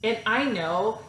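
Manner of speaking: usual speaking voice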